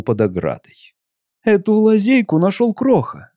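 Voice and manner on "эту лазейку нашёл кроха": mildly voiced Sirina, a little higher, excusing herself; lightly voiced woman explaining; apologetic. A narrator's reading. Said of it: "оправдываясь".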